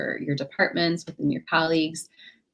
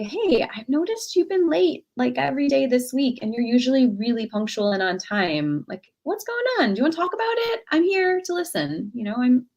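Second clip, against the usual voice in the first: much higher, gentle concern; caring check-in; 6 semitones higher than usual. Shown as a demonstration. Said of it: "You might say".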